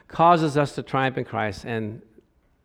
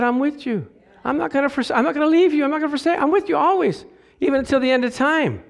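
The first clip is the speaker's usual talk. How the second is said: voicing the Lord; 11 semitones higher, faster than usual. Voicing God's promise.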